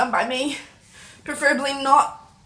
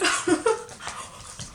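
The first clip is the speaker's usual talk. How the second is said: high, voiceless burst of laughter; breathy laugh; 7 semitones higher than usual. Real, not acted. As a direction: laugh breathlessly through the chili burn. Laughs after blaming Frank.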